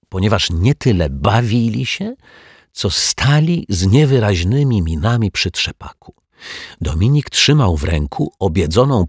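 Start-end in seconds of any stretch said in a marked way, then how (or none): none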